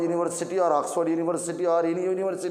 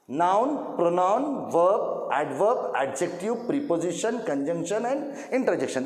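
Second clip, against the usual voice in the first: slow drill; much slower than usual. Shown as a demonstration. Teacher drilling the list again.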